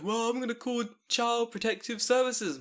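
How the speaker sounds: a hint of a smug child; mimicking a smug kid. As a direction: play the cheeky child threatening. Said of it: "they'll be like".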